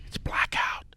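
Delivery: breathy whisper, almost no voice; whispered aside. Real, not acted. Cohost whispers the bad news about blackout dates to the caller, hushed. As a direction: lean in and whisper the punchline.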